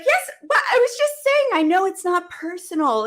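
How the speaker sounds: high, rushed, defensive protest; startled protest. Retold or imitated. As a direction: push back, caught off guard. Her defensive retort to the coach.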